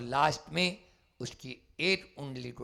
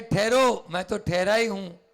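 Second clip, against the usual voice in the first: loud command; 7 semitones higher, 10 dB louder than usual. Acted. Bandit orders halt, monk answers.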